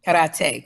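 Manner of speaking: Karate with a tapped R, Spanish style; rolled-R karate. Shown as a demonstration. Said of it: "that's the way it's supposed to be said".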